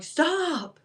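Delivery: high, sharp stop; urgent command. Retold or imitated. Jay ordering her to stop during the cat attack, high and sharp. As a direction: cut her off with one high word. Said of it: "And Jay was like".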